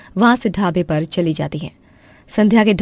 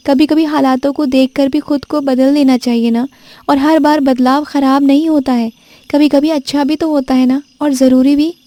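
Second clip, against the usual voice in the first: put-on higher young woman, earnest and a bit louder; advising sister-in-law; 7 semitones higher, 4 dB louder than usual. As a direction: press the point home kindly. (भाभी को समझाती ननद, ऊँची आवाज़ में)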